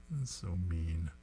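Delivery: whispered through a giggle; soft breathy laugh on the words. Real, not acted. Laughing aside after comic joke.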